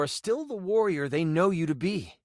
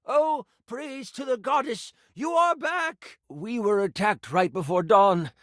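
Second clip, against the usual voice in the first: village priest. Acted. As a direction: praise the goddess, then sink into bad news. Voices the priest greeting the returned player.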